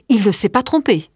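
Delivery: plain reading voice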